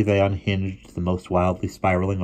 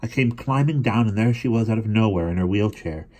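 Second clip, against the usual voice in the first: usual speaking voice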